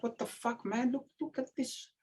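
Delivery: high, softened alarmed aside; hushed disbelief. Retold or imitated. Teammate backstage seeing the rival's physique, hushed disbelief. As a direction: squeak it quietly, high and aghast.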